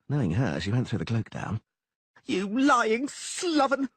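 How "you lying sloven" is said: high, slow, loud scream. Acted. Blonde woman screams at Dandelion from the balcony. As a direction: drag out the insult. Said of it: "the blonde screamed".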